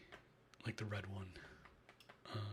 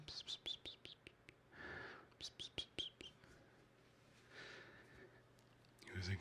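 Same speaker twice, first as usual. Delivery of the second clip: whispered psst cat call; 5 semitones higher than usual. Real, not acted. Calling cats.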